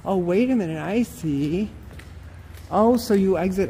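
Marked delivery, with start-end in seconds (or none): none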